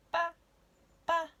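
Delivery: small chick squeaking; tiny squeak. Acted. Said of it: "she squeaked in a minuscule voice".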